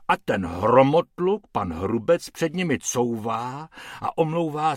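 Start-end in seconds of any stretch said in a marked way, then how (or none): none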